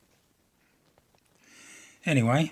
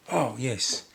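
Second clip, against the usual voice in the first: ordinary delivery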